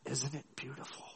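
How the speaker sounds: soft, hushed lean-in to a neighbour; a gentle hushed question during worship; quiet aside. Retold or imitated. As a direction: murmur it so only he hears. She leans over to him.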